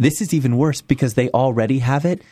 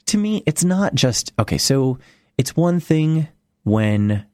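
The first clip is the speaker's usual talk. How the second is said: ordinary delivery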